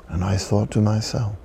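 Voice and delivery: ordinary talk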